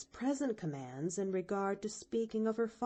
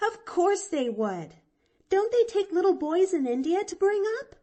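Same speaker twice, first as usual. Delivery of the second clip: put-on high, eager little girl; lively girl voice pitched higher; young girl voice; 8 semitones higher, 9 dB louder than usual. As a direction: chirp it out like a bright chatty child. Pollyanna reassures Jimmy eagerly.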